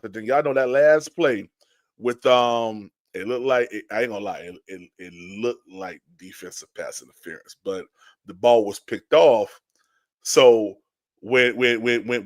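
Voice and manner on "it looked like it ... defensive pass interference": usual speaking voice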